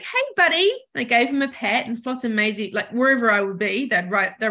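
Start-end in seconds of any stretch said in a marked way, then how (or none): 0.0-0.7 hey buddy. Retold or imitated. Greeting her cat tenderly.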